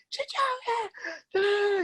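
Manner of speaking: sudden high cry of a name; retelling a coach who finally snaps; coach yelling name. Retold or imitated. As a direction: shriek the kid's name across the field. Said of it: "He's, like, yelling and screaming".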